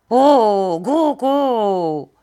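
put-on loud wordless old man's outburst; strange rattling cry. A narrator's reading. Old man ends his rant, strange loud cry. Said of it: "ressemblait à une sorte de râle inconnu".

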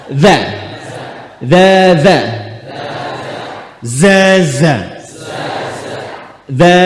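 loud, clearly voiced "Za" repeated; loud za drill. Shown as a demonstration. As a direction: call out "Za" firmly, one at a time. (ucapkan "Za" dengan keras dan jelas)

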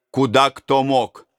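usual speaking voice

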